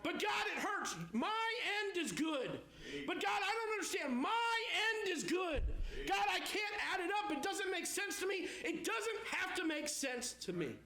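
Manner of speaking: a preacher pleading and answering at the top of his voice; full-force preaching. Acted. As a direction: shout the promise over the pain.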